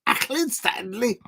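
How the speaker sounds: put-on quoting the old milk advert in a Scouse accent; imitating a Scouse kid. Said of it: "I can't do that accent".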